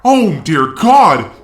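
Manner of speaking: normal talking voice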